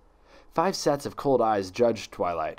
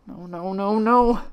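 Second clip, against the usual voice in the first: rising, anguished refusals; tearful denial; 10 semitones higher, 5 dB louder, slower than usual. Acted. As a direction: fall apart into denial. Twilight shaking head in tears.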